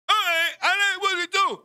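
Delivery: high, loud half-formed speech; imitated deaf man. Deaf Bear responding to Barbara, imitated high loud speech. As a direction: call out eagerly in mimicked blurred words. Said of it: "this guy is deaf".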